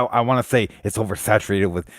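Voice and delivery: usual speaking voice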